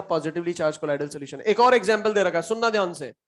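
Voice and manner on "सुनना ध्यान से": usual speaking voice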